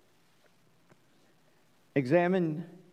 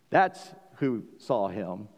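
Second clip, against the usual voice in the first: ordinary talk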